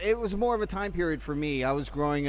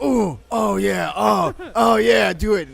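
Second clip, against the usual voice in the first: slow, loud hammed-up moaning; exaggerated workout-or-sex grunting; mock moaning; 3 semitones higher, 8 dB louder, slower than usual. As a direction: moan it out, drawn out and loud. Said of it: "it starts out where they're like".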